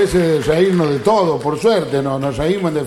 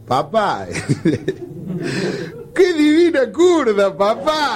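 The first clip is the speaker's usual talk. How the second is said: slow, high, sing-song tipsy drawl; drunk son's slurred praise; 3 semitones higher, much slower than usual. Acted. Son teasing his drunk father, slow and high. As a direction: stretch it out like a happy drunk. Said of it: "le dice al padre".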